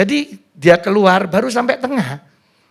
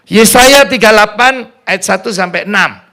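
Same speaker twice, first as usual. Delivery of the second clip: loud announcement; 2 semitones higher, 8 dB louder than usual. Real, not acted. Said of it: "repeated reference".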